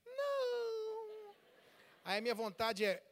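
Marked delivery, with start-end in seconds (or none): 0.1-1.3 high, drawn-out little no; mock a wife's plaintive denial; imitated wife. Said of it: "minha esposa vai dizer assim".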